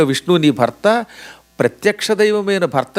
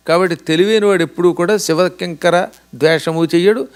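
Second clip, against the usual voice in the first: normal talking voice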